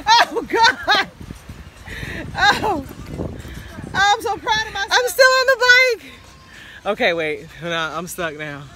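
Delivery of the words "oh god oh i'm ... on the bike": excited. Real, not acted. Struggling on bike in sand.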